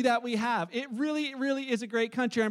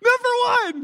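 much higher and louder, crowing number one; triumphant shout; 11 semitones higher, 11 dB louder than usual. Real, not acted. Pastor crowing to church about Canada's hockey, triumphant shout. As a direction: shout it like a hockey fan's victory cry.

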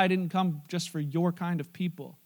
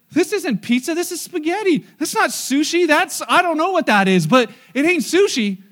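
higher, louder complaint about wrong food; mock outraged diner; 7 semitones higher, 8 dB louder than usual. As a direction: play the baffled customer, hammed up loud.

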